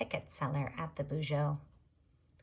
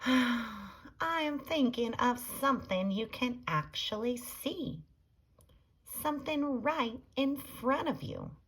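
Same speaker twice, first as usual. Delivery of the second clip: higher-pitched, exasperated prompting; 6 semitones higher than usual. A narrator's reading. Beatrice hinting to Leroy about horses, exasperated. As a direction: spell it out for someone missing the obvious.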